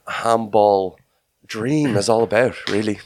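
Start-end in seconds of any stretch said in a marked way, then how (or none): none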